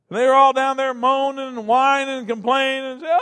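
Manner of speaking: mounting outrage for the congregation; heated preaching. Acted. Preaching describes the whining crowd.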